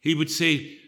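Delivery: usual speaking voice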